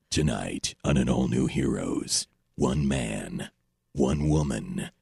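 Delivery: deep, slow trailer announcer; booming movie-trailer hype; dramatic promo voice. Shown as a demonstration. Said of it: "I produced this thing with this".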